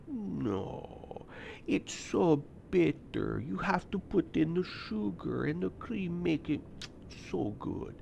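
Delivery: soft, slow, hushed charmer; suave Italian. Retold or imitated. Italian coworker explaining sweet coffee in a hushed, smooth voice.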